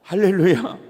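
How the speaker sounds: normal talking voice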